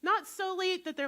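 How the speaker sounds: ordinary talk, nothing marked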